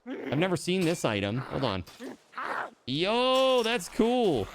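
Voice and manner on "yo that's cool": excited. Real, not acted. Surprised by new item.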